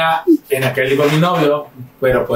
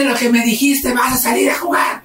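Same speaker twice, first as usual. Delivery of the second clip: high-pitched mock indignation; incredulous scolding in a high mother voice; outraged mother; 9 semitones higher than usual. Mother reacts to son asking to go out, high indignant voice. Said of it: "fue mi mamá así de".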